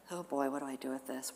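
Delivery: ordinary talk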